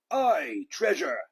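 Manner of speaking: loud pirate crew cheer; crew shout. A narrator's reading. Pirate crew shouting about treasure. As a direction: roar it as the greedy crew. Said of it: "the others shouted".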